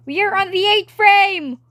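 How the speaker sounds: loud, high triumphant shout; hyped-up, high-pitched yell; excited announcement. Real, not acted. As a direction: announce it like a big milestone. Hyping up own animation progress.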